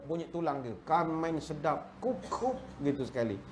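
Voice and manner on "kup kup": soft bone crack sound. Retold or imitated. Imitates bones cracking.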